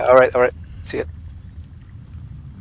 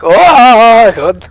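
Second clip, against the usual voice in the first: high, loud cry to flee; loud shout; 8 semitones higher, 10 dB louder than usual. Real, not acted. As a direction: yell at them to run. Being chased in game.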